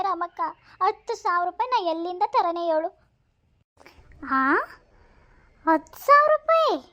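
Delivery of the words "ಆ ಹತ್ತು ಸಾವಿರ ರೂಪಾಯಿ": much higher, louder incredulous echo; shocked disbelief. Acted. Friend echoes the huge sum in shock, high loud disbelief. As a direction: repeat the sum as if it floored you.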